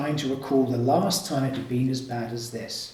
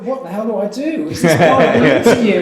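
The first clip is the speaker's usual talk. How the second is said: laughing panic; 5 semitones higher, 11 dB louder than usual. Retold or imitated. Retold panicked thought.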